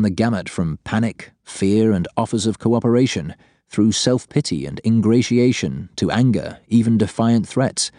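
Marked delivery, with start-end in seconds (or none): none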